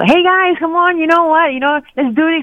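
rally the pack like a cheerleader. Shown as a demonstration. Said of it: "I'm very expressive when I cycle".

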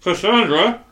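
loud, high call to an assistant. Acted. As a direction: summon someone from the next room. Calling to assistant after a knock.

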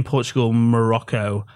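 ordinary delivery

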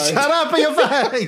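high, loud, laughing Italian-American voice; mock Italian tough guy. Echoing the cohost in a laughing mock Italian voice. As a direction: echo the phrase as a cartoon Italian. Repeating cohost's line in character.

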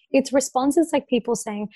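usual speaking voice

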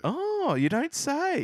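high, sing-song sarcasm; mock surprise. Co-host reacts to an obvious tip, sarcastic mock surprise. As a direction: feign amazement in a high, slightly loud voice.

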